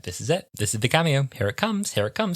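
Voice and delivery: building up to a high excited squeal; mock excitement. Fan on the sofa hyping for a cameo, rising excitement. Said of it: "I went full clown hour".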